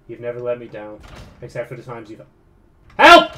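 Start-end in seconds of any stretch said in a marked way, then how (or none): 3.0-3.3 sudden high, loud yelp for help; startled shout. Real, not acted. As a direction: cry out as if caught by surprise. Startled cry in game.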